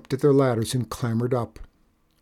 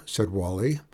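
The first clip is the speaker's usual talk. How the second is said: plain reading voice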